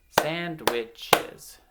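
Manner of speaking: "sandwiches" split firmly as sand, wich, es; syllable by syllable. Shown as a demonstration. Teacher clapping the first word with children, syllable by syllable. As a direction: clap out "sandwiches" in three even beats. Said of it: "clap the syllables".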